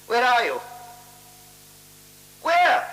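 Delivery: high, loud panicked call; frantic shout. Acted. Mr Barton calling for his lost companion, shouting. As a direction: shout into the dark for him.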